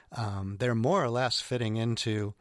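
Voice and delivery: ordinary talk, nothing marked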